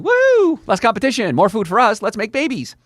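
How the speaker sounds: high, loud party whoop, then excited chatter; whooping, high-pitched glee; gleeful yeast. Pretend. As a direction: celebrate like a yeast let loose. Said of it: "the yeast are going".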